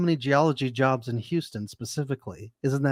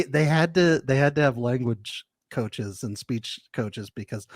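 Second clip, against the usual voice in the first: ordinary talk, nothing marked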